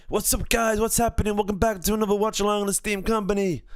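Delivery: high-pitched mock American streamer accent; put-on accent. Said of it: "was that convincing? Which, which accent?".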